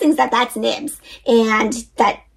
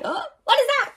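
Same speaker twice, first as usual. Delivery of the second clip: startled; 13 semitones higher, 5 dB quieter than usual. Acted. Frightened at the noise.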